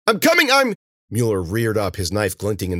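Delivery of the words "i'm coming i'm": high, loud, frantic call; urgent shout. Acted. Cece rushing to help Ben, shouting. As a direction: shout reassurance across a struggle.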